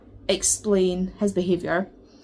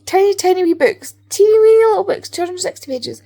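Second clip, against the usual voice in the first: rising higher and louder with each tiny; gushing; 11 semitones higher, 11 dB louder than usual. Real, not acted. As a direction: squeal over how tiny they are. Gushing over small books.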